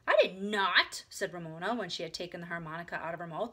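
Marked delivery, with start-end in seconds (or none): 0.1-1.0 loud, stubborn contradiction; defiant denial. A narrator's reading. Little sister denies promise, defiant. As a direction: deny it like a stubborn child.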